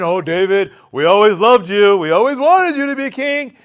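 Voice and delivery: loud, syrupy mock flattery; fake flattery. Former enemies flattering David, loud fake sweetness. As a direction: lay the phony praise on thick. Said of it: "here they come saying".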